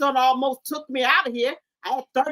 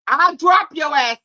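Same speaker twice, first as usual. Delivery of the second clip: loud threat; 8 dB louder, slower than usual. Acted. Threatening kid in rant.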